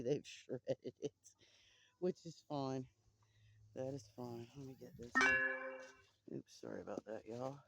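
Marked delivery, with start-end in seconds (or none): none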